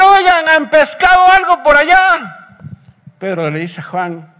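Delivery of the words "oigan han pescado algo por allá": high, loud call across water; carrying, high-pitched hail; shouting from shore. Retold or imitated. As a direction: holler the question to men far out in a boat. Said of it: "desde la orilla empieza a gritarles".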